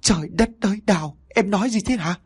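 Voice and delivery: high, aghast exclamation; husband hearing his wife confess to murder, aghast; thunderstruck. Acted. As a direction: cry out in horror at her words. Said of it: "như bị sét đánh ngang tai".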